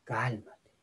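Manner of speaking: low, hushed word to oneself; self-calming. Shown as a demonstration. Telling oneself to stay calm, low and soft. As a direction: talk yourself down, softly and low. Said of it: "Hay que decirnos cosas".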